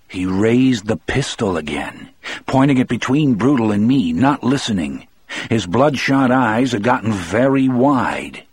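ordinary delivery, nothing marked

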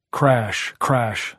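ordinary delivery, nothing marked

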